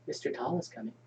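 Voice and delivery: creepy whisper. Acted. Child whispering creepy line.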